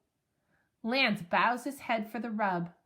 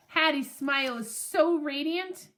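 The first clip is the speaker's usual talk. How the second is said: ordinary delivery, nothing marked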